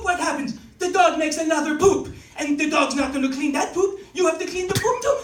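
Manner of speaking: loud French Canadian rant; French Canadian accent. Acted. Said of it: "like, French Canadian accent".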